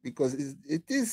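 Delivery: ordinary delivery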